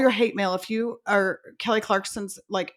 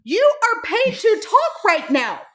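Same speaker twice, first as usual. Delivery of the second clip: much higher, louder and slow, stretched in disbelief; exasperated disbelief; 12 semitones higher, 4 dB louder, slower than usual. Real, not acted. Host ranting about a choked-up commentator, higher, louder disbelief. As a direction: throw your hands up at the screen. Exasperated rant at [name].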